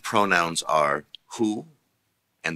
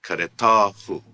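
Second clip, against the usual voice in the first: karetahu with stress on ta, said louder; 2 semitones higher, 5 dB louder than usual. Shown as a demonstration. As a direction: push karetahu with weight on ta. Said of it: "Note the change in the stress".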